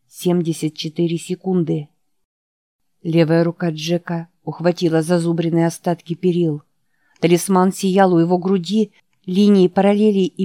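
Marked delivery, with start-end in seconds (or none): none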